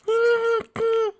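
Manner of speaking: put-on squeaky little-girl laugh; tiny high giggle. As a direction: giggle high like a small filly. Excited filly.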